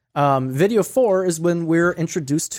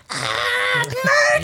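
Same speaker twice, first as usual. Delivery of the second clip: very high mock influencer shriek; hammed-up piercing cheer; over-the-top squeal. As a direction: squeal with exaggerated photo-shoot glee. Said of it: "over the top".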